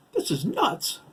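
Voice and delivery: high, loud exclamation; alarmed, high-pitched outburst; panicked cry. A narrator's reading. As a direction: cry out in frightened disbelief. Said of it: "Dabney cried".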